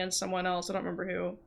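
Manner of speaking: ordinary delivery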